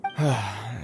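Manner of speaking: short sigh of giving in; resigned sigh. Acted. Knight agreeing to leave the child, resigned sigh. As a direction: breathe out, beaten.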